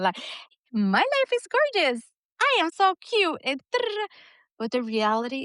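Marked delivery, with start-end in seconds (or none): 0.7-3.6 high, sugary influencer gush; mock a perfect-life social media post; gushing reel voice. Mocking thirty-second reels in a high gushing voice. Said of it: "those thirty-second reels".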